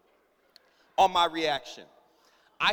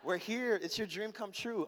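soft, drawn-out wheedling; mock-sweet pleading; sweet-talking; 9 dB quieter, much slower than usual. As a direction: coax like a wife making up. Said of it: "try to get on your good side".

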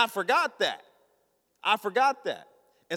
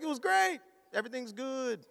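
high-pitched fake casual reply; falsely breezy; 6 semitones higher, 5 dB quieter than usual. Husband hiding his joy from wife, falsely breezy. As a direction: pitch it up, then toss off the end softly.

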